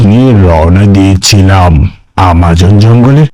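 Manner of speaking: low, measured storyteller voice; horror story narration. A narrator's reading. Narrator setting up a ghost story, low and measured. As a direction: set the eerie scene in a low voice.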